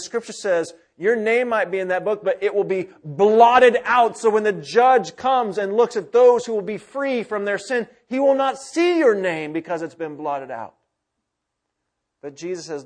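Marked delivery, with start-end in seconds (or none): 1.0-10.7 fiery preaching. Acted. Preaching at full force.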